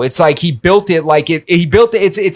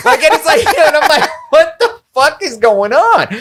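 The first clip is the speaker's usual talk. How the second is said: laughing disbelief; 11 semitones higher than usual. Real, not acted. Laughing in disbelief at drinking experiments.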